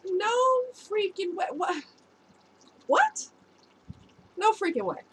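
high, stunned reenactment, broken by pauses; acted disbelief. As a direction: replay the double take in rising disbelief. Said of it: "I'm staring at it like".

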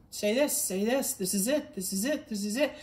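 quick run-on inner prompts, no pauses; imitating instant, repeated inner promptings; rapid-fire prompting. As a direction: fire off instructions without stopping to think. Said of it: "There's no pausing, there's no thinking it over".

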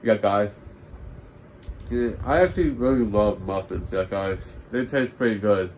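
chewing between words; talking while chewing. Real, not acted. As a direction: mouth partly full. Seen 1: Bites muffin at -1.0, then mouth works while talking.

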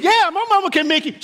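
loud, high, quick proud brag to a buddy; excited boast. Retold or imitated. Teen to friend asking for suit, excited loud brag. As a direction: crow about mama's sewing.

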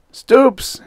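high, loud furious outburst; husband bursting in the door, furious cry; angry cry. Acted. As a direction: blurt it out in a rage. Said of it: "he cried".

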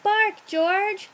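storybook mama voice giving an order; mother dog's command. A narrator's reading. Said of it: "George's mother tells George".

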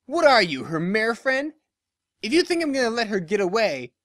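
mocking taunt. Dash taunting Barry after snorting, mockingly. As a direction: scoff at her.

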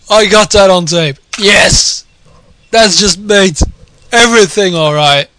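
high and loud, still buzzing; thrilled. Real, not acted. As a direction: ride the high of the moment. Still celebrating the play.

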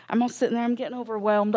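normal talking voice

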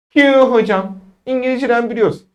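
high-pitched pupil answering; student piping up with the answer; acted student.

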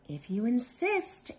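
sweet and light, rising at the end; sweet reply. Acted. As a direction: answer sweetly, unbothered by the bully.

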